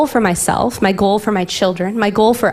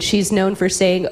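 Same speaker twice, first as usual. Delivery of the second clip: usual speaking voice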